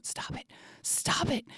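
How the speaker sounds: urgent whisper; whispering to a friend to stop laughing. Retold or imitated. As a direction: hiss it under your breath. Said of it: "looking at my friend going".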